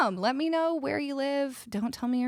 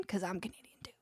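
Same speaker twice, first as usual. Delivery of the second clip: whispered secret aside, much softer; conspiratorial whisper to fellow Canadians; playful whisper; 3 semitones lower, 8 dB quieter, faster than usual. Real, not acted. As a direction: lean in and whisper it like a shared secret. Playful confiding aside to chat.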